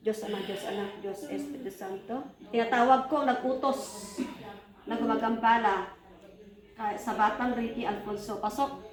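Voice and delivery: low, soft incantation under the breath; a healer's hushed invocation to drive a spirit out; murmured prayer. Acted. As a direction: pray quietly over the child, steady and hushed. Healer's prayer over the child.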